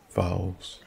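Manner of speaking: ordinary delivery, nothing marked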